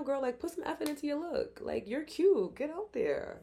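high-pitched cheering-on; girlfriend pep talk. Acted. Friend urging a girl to dress up, high encouraging voice.

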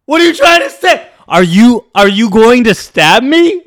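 high, loud incredulous outburst; alarmed disbelief. Retold or imitated. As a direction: demand answers in mock panic. Alarmed confrontation with passenger, retold.

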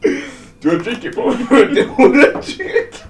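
high, laughing through the words; cracking up while asking. Real, not acted. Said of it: "Ryan just spat his drink".

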